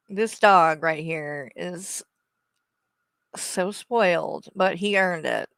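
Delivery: ordinary talk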